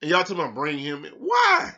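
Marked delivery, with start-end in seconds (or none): 1.1-1.7 high, loud incredulous why; fed-up shout of why; exasperated why. Real, not acted. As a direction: throw the question up in disbelief. Exasperated rant about trade idea.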